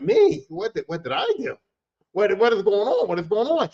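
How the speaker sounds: very high, shocked protest; who, me?; indignant surprise. Retold or imitated. Bouncer pointing at her, shocked high protest.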